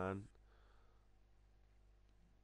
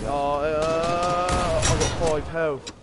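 loud, raised alarmed cry; alarmed shout; 6 semitones higher, 10 dB louder, much slower than usual. Real, not acted. Nearly dead in game.

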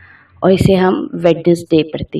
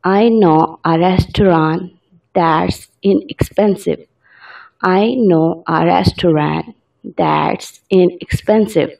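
very slow, word by word dictation; slow example sentence; much slower than usual. Shown as a demonstration. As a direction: read it out slowly for learners. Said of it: "example sentence".

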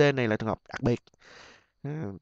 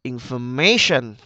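slow Information, MA lifted and loud; stress on third syllable; 5 dB louder, slower than usual. Shown as a demonstration. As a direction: say Information as in-for-MA-tion. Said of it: "Information âm ba". (nhấn âm ba Information)

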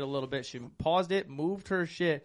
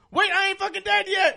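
high, loud squeaky protest; high dying NPC protest; 9 dB louder than usual. Retold or imitated. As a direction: insist you're still alive. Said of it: "he goes".

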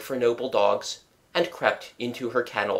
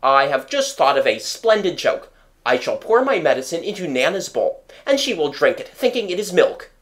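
lively, raised-pitch plotting; gleeful scheming; 5 semitones higher, 4 dB louder than usual. A narrator's reading. Father shares his splendid joke, gleeful. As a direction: pitch the prank with relish.

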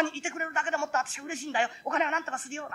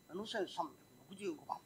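much softer, slow hushed voice; hushed shock; stunned whisper; 7 semitones lower, 18 dB quieter, slower than usual. Acted. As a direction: mouth the number in disbelief. Comparing ticket, stunned.

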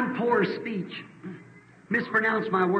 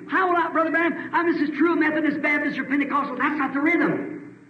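higher, fast-driving preacher, shouting in places; preaching at full force; 4 semitones higher than usual. Real, not acted. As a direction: hammer the rhythm home like a revival preacher.

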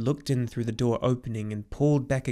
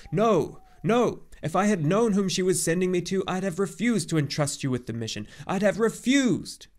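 high, loud, vehement refusal; blurted outburst; 7 semitones higher, 4 dB louder than usual. A narrator's reading. Centaur refusing to trust a boy with the mission, blurting. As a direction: blurt it out, peaking on the last words. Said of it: "he blurted out".

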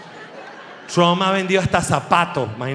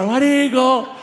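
party cheer; 7 semitones higher, 3 dB louder, much slower than usual. Retold or imitated.

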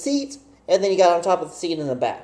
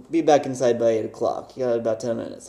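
lightly voiced stern dad, lower; calm fatherly warning; a hint of dad; 9 semitones lower, 5 dB quieter than usual. Dad setting curfew, lightly voiced lower fatherly warning. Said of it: "I'm like".

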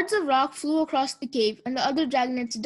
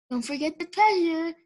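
urgent hissed prompt, pitched up; 4 semitones higher than usual. A narrator's reading. Tsunami prompts Sunny mid-game, hissed reminder. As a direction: hiss it as a stage prompt. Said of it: "Tsunami hissed".

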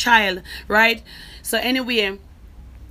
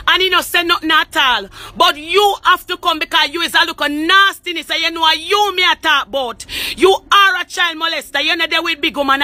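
higher, heated accusing voice; angry rant; 4 semitones higher than usual. Real, not acted. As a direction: lash out at him, voice pitched up and hard. Heated accusations at family member.

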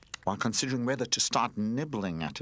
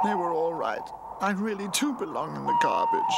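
tearful self-pity; 10 semitones higher, 4 dB louder than usual. A narrator's reading. Runaway toy despairs alone.

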